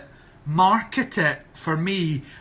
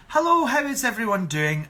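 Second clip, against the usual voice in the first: higher, easy-to-follow Scottish greeting; polite Scottish voice, pitched up; clear Scottish accent; 7 semitones higher than usual. Shown as a demonstration. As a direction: demonstrate a light, understandable Scottish lilt. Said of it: "get the kind of Scottish flavour".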